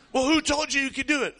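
mock the busybody's outrage, up high and loud; angry stranger. Stranger demanding who allowed the hunt, high and loud.

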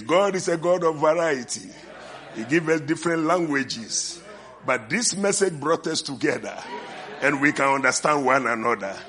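loud, rising church preaching. Acted. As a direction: preach it out to the congregation. Preaching at full force.

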